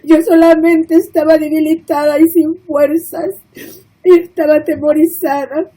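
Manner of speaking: ordinary delivery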